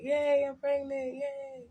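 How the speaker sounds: feigned happy. Retold or imitated. Mother-to-be pretending to be happy about pregnancy, feigned cheer. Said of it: "acting like I was happy".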